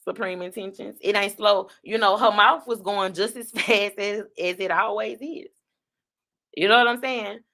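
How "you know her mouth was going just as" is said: usual speaking voice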